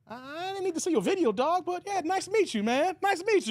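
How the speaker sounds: awkward fan. Retold or imitated. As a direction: brush him off politely. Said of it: "you look at the phone like".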